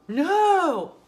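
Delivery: high, loud outraged no; shouted no. Real, not acted. Mom venting to viewers with outraged shout. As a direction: shout it in disbelief. Exclaims about husband forgetting son.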